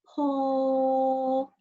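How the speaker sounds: "po." released with a puff of air; aspirated po. Shown as a demonstration. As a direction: let the air burst on "po.". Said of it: "the wind come up from the mouth".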